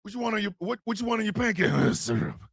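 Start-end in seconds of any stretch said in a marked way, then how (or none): 1.7-2.4 a deep, grunting mock growl; growled answer. Growling man ordering pancakes, answering in a deep mock growl. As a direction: grunt the word like a growling brute.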